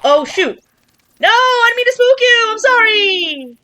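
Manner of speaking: high, loud apologetic cry; sorry, didn't mean to spook you; startled apology. Real, not acted.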